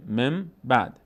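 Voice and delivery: مم بعد with a closed, held m; n turned to m. Shown as a demonstration. Teacher repeats the model, مم بعد with m for n. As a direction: hum from مم into بعد.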